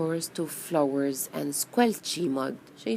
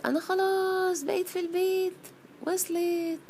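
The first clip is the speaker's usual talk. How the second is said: high, singsong little squeal; happy bee voice; 12 semitones higher, 3 dB quieter, much faster than usual. Acted. Bee tells everyone it got home, happily. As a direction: pipe up with relief, high-pitched.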